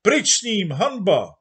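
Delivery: high, loud jeering shout; crowd shouting. Acted. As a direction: boo him out as a mob. Crowd demanding Mikula's removal. (dav pokřikuje hanba)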